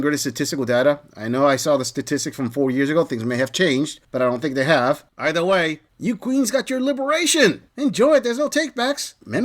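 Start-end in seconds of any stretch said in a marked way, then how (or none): 6.0-9.1 mocking sarcasm. Mocking women, addressed as queens.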